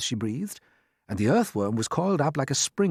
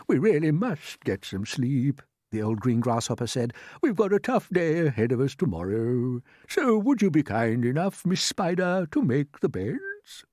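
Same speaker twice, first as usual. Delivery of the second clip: lightly voiced old grasshopper; 3 semitones higher than usual. Grasshopper asking Miss Spider for beds, lightly voiced old gentleman. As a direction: gently ask like a polite elder.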